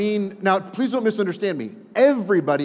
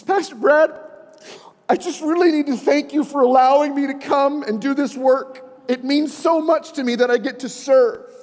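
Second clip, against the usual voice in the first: high, loud earnest thank-you in a woman's voice; grateful Cindy; 9 semitones higher, 4 dB louder than usual. Retold or imitated. Volunteer thanking her pastor, high and earnest. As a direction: pour out thanks like a tearful volunteer.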